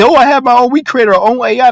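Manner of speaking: hammed-up boastful voice; mock bragging tech guy. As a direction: parody the smug corporate guys. Mocking rich crypto guys.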